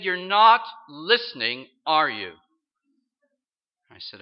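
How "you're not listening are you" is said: secretary mocking the visitor, loud and condescending; mock scolding secretary. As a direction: scold him like a bored gatekeeper. Secretary voiced scolding the preacher.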